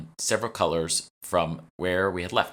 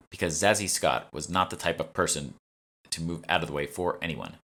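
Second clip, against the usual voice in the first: plain reading voice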